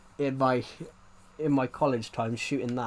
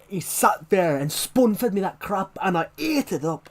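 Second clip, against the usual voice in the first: loud, slow, higher-pitched aggressive Scottish; aggressive Scottish accent; 6 semitones higher, 5 dB louder than usual. Retold or imitated. Streamer imitating his actor's surprise performance, aggressive Scottish accent. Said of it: "what his Scottish accent was gonna be like".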